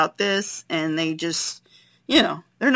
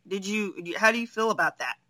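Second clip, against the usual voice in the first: usual speaking voice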